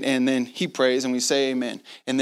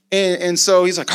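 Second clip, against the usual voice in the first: ordinary talk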